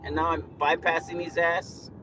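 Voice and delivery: loud angry outburst at a driver; real road rage yelling. Real, not acted. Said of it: "Oh, sorry. I'll get back to the subject".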